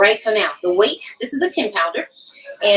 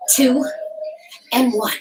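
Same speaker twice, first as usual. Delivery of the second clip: ordinary delivery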